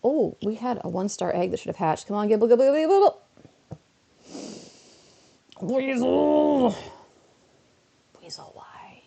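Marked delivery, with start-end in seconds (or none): 2.1-3.2 rising louder higher coaxing; excited pleading. Real, not acted. Urging egg to hatch. 5.6-6.8 loud high dismayed shout; disappointed outcry. Real, not acted. 8.2-9.0 whispered despair. Real, not acted. Despairing aside.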